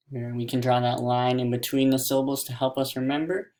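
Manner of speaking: ordinary delivery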